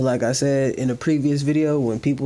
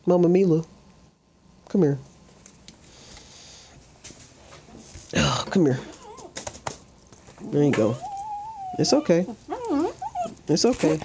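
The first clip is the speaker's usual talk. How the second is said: higher, soothing pet voice; 6 semitones higher than usual. Real, not acted. Owner cooing to scared rescue dog, gentle baby talk.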